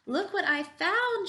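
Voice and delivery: high, loud and drawn-out announcement; triumphant shout. A narrator's reading. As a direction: shout your great find proudly. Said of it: "she shouted triumphantly".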